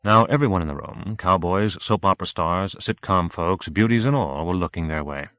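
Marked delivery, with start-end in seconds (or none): none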